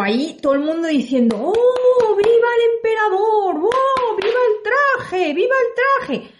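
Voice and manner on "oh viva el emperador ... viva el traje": high, drawn-out fawning cheers from the crowd; cheering crowd. Acted. Townsfolk hailing the emperor's invisible suit, high slow cheer.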